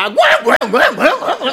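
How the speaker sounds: high squealing pig grunts gouin; pig squeals. Acted. Storyteller squeals as the pig crying below the tree. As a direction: squeal like a frightened piglet. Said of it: "cochon qui a crié en bas".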